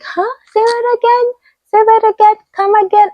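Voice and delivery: high-pitched puzzled badgering; imitating confused people in a high voice; mimicked baffled listeners. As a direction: pitch it way up, mock not understanding. Mimics people not understanding her accent.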